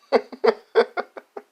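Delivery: loud, high burst of laughter; laughing. Real, not acted. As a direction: crack up loudly. Cracks up at own "girl.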